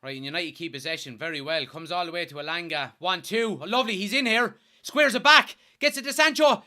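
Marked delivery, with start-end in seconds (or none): 3.0-6.6 getting more and more worked up, faster and faster; rising excitement. Real, not acted. Fan watching his team attack, getting louder and higher. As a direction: voice climbing higher and louder as the chance builds. Goal chance building on stream.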